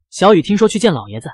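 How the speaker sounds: usual speaking voice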